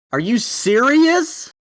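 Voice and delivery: high, loud and slow outraged disbelief; can't believe the dice did that; exasperated disbelief. Real, not acted. Dice roll fails again.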